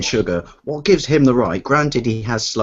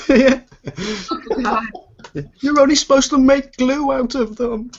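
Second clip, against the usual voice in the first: mock horse voice; 13 semitones higher than usual.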